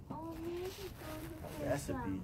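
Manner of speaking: a drawn-out, high, scoffing "A recipe?"; mocking disbelief. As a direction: sneer at "A recipe?" like it's a joke, stretched very slow. Scoffers mocking Colonel Sanders.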